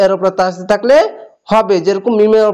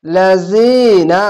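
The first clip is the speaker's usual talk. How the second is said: slow, stretched Quranic reading; drawn-out recitation; much slower than usual. Shown as a demonstration. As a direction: draw each vowel out long. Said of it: "এক আলিফ টেনে পড়বেন".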